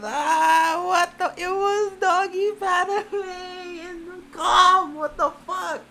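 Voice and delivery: high, loud crying shriek; shrieking, half crying; horrified wail. Real, not acted. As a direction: wail it in disgust. Horror at hamster scene.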